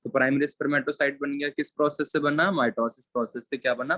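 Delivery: normal talking voice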